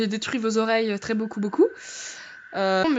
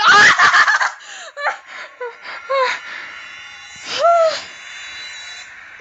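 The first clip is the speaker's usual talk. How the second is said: long, very high shriek of fright; piercing scream breaking into panting cries; 13 semitones higher, 4 dB louder than usual. Real, not acted. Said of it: "comment j'ai crié!".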